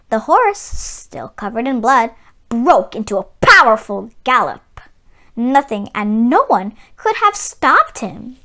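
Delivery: plain reading voice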